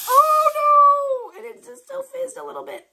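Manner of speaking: high, loud panicked squeal. Real, not acted. Real panic over a nearly dropped drink. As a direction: shriek as the drink nearly spills. Almost drops her Sprite.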